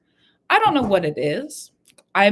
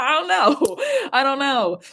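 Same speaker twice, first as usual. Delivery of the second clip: laughing; 8 semitones higher, 3 dB louder than usual. Real, not acted. Laughing at her own question.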